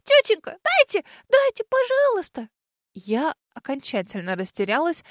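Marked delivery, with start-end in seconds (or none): 0.0-2.5 very high whining plea; little girl begging for the dragon; whiny child pleading. A narrator's reading. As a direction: whine and beg like a little kid. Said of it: "умоляюще захныкала".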